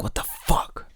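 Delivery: a hushed, exasperated whisper; whispered curse. Retold or imitated. Tearing apart the basement looking for pliers, whispered curse. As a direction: whisper it under your breath in disbelief. Retold muttered curse while searching.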